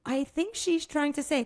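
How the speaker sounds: puzzled native speaker with a hint of Spanish accent; English with an amateur Spanish accent; attempted Spanish accent. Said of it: "trying to do a Spanish accent".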